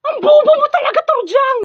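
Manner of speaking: high furious yell; angry shout. Acted. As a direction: scream the insult. Yelling at classmate.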